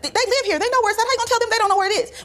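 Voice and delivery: high-pitched arguing back; retold exasperated protest. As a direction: argue it back in a high, incredulous voice. Retold argument with her husband.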